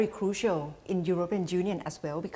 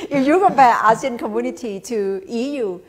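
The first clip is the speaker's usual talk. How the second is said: laughing, a bit louder; 5 semitones higher, 10 dB louder than usual. Real, not acted. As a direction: still chuckling as you talk. Laughs after joke on breakup.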